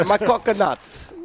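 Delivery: slow, drawn-out Jamaican repeat; Jamaican accent. Pretend. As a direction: stretch it out slowly in a Jamaican accent. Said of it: "That's Jamaican".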